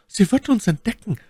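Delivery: high, urgent lady's protest; Milady's voice. A narrator's reading. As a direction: object anxiously, pitched far up. Milady fears the patrol. (ängstlicher Einwand mit hoher Stimme)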